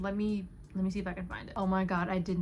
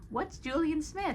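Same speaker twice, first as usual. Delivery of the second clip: put-on childlike question; 9 semitones higher than usual. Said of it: "I was like".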